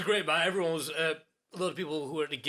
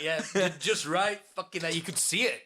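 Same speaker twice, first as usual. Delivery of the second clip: normal talking voice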